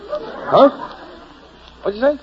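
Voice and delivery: sudden high, loud double take; stunned surprise. Acted. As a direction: jolt up as the news lands. Hears he'll have a child.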